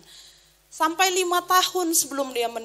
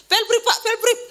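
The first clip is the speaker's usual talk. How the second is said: imitated urging; 7 semitones higher than usual. Acts out children urging their father.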